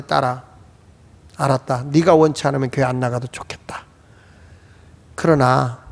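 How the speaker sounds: low, hushed motherly concession; calm resignation. Retold or imitated. Mother answering her daughter's outburst, calm low resignation.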